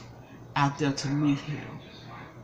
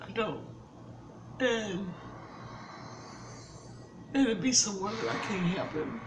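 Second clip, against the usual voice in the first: real tears, voice fading to near silence; crying; 9 dB quieter than usual. Real, not acted. Grandmother telling viewers she can't help her grandson, quietly crying. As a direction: choke back tears over the grandson. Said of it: "forgive me for crying".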